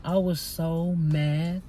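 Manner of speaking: slow, stretched-out "so mad"; drawn-out venting. Real, not acted. Telling viewers about the argument at work, drawn-out venting. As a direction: vent it slowly, leaning on the words.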